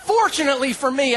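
ordinary talk, nothing marked

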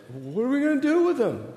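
lightly voiced small boy's question; kid voice. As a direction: pipe it up like a small son asking. Son asking, high voice.

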